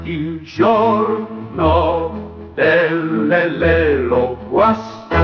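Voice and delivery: very slow, each syllable stretched; slow spelled out. Shown as a demonstration. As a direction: drag the title out syllable by syllable. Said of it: "sillabato con lo spelling".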